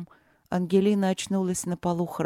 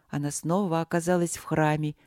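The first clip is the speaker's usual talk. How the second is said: plain reading voice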